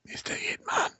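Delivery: whisper. Retold or imitated. Imitating a whispered voicemail plea.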